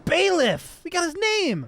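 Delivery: high, loud excited outburst; excited shout. Real, not acted. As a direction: shout it in delighted discovery. Excited shout on hearing name.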